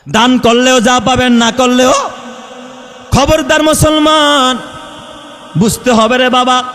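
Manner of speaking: loud, high sermon cry; fiery preaching. Acted. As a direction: thunder the warning at the crowd. Preaching at full force. (জোরালো ওয়াজের হাঁক)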